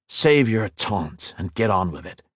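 ordinary delivery, nothing marked

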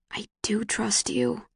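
slow, breathy whisper. A narrator's reading. Tris wishes she could tell Tobias this, whispering. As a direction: whisper it like an unspoken thought. Unspoken words, only thought.

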